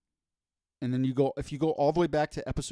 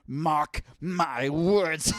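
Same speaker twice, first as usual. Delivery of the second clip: theatrical villain vow, building to a drawn-out cry; grand villain monologue, very slow at the end; supervillain voice; slower than usual. Pretend. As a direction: swear vengeance like a hammed-up supervillain. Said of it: "carried away sometimes with your voice".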